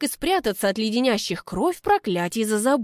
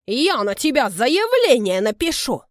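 angry threat; 3 semitones higher, 5 dB louder than usual. Acted. Quarrel, threat shouted.

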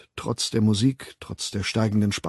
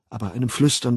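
ordinary delivery

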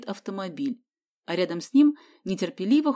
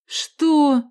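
loud, high startled question; wide-eyed astonishment; 7 semitones higher, 8 dB louder than usual. A narrator's reading. Boy hears he ranks below Carter, astonished. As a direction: blurt it in disbelief. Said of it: "вытаращил глаза её спутник".

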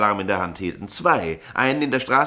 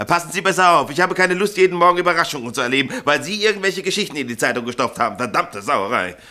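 raised, higher voice, scolding fast; raised voice, angry, rushing on; 5 semitones higher than usual. Acted. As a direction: tear into the careless printer. Editor scolds printer.

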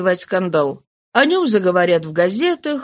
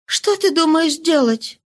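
higher, slowed question; slow questioning woman; 8 semitones higher than usual. Acted. Mistress questions her lover, slow and high. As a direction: ask him slowly.

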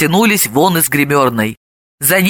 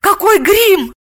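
high-pitched indignant outburst; indignant young heroine, high voice; 11 semitones higher, slower than usual. A narrator's reading. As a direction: protest sharply, pitch leaping up. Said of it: "возмутилась Снегурочка".

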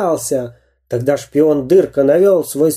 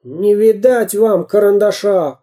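higher, defiant retort; defiant toy man; 7 semitones higher than usual. A narrator's reading. Samodelkin defies the pirates, defiant higher voice. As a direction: refuse them boldly.

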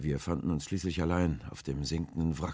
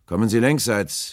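loud, high hail; calling out; 7 semitones higher, 11 dB louder than usual. A narrator's reading. Will coaxes the rower alongside, calling loudly. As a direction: holler an invitation across the water. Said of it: "rief Will dann".

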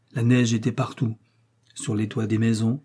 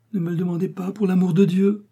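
higher, louder rising plea; desperate plea; 9 semitones higher, 3 dB louder than usual. Acted. Sarah begging Milly, voice climbing desperately. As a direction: beg her to stop asking, voice climbing.

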